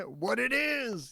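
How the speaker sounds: slow, drawn-out high catchphrase; mock dramatic. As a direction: say it like a silly meme. Friends joking about wrong date.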